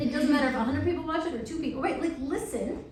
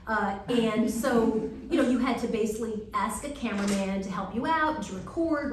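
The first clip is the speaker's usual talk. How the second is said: ordinary delivery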